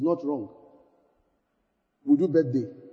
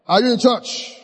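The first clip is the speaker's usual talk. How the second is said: called out; 4 semitones higher, 6 dB louder than usual. Acted.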